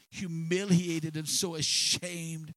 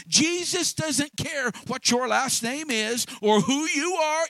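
preaching at full force; 4 semitones higher than usual. Acted. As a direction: drive it home like a revival preacher, slowly.

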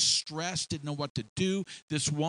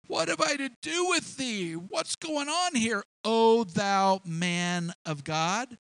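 upset widow; 7 semitones higher than usual. Acted. Preacher voicing the distressed widow.

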